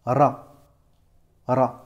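ra with a rolled R and open A. Shown as a demonstration. Teacher modelling the syllable ra with a rolled R. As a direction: trill the R of ra, full A. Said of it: "tu vas prononcer ça".

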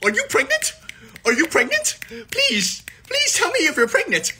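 squeaky high mock chat begging to know. Imitating the chat pestering him about pregnancy, squeaky high. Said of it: "and everyone's like".

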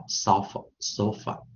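sofa, sofa, slow and careful; modelled sofa. Shown as a demonstration. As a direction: spell out sofa by sound. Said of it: "有的人会念".

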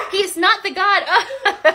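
sentence dissolving into startled real laughter. Real, not acted. Kid scares her mid-sentence.

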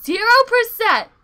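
a loud, high cry of dismay; dismayed shout. Real, not acted. Friend sees battery at zero, loud dismayed cry. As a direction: yell at the empty meter in disbelief.

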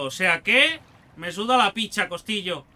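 usual speaking voice

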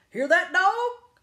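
loud, high, drawn-out call; bellowing farmer. A narrator's reading. Farmer warning his dog of the storm, bellowing. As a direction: holler it to the dog. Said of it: "he bellowed".

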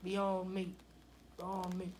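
ordinary delivery

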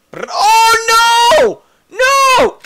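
loud high scream of panic. Real, not acted. Character being crushed.

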